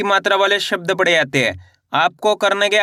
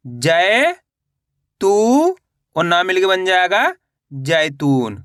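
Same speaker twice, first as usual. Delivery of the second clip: drawn-out syllables rising at the end, then the word falling; spelling drill; slower than usual. Shown as a demonstration. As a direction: sound it out slowly for beginners, stretching each syllable. (मात्रा जोड़कर खींच-खींचकर पढ़ाना)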